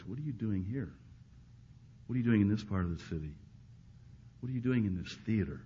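very soft, searching questions; God's quiet voice, asking with pauses; gentle inner voice. Acted. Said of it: "you might hear that voice".

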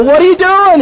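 high-pitched outraged question; mock disbelief. Man to friend throwing away his joint, mock outrage. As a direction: act out an incredulous protest.

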